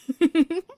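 high, loud giggle of delight; teasing laugh. Acted. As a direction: burst into a bright teasing giggle.

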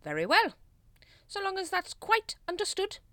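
high, loud stern authority; clipped leader's warning; imperious Grand Master. A narrator's reading. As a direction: lay down the law pompously.

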